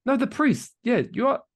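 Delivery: high excited protest. Real, not acted. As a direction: jump in high and eager. Excited correction in argument.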